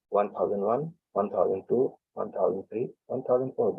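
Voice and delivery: calm instructor counting the breath hold; guided breath count. Shown as a demonstration. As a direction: count it out evenly to pace their breathing. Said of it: "leads a counted breathing demo".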